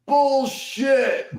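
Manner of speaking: high, loud skeptical shout; heckler from the back. Retold or imitated. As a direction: yell it in disbelief from far back. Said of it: "you hear".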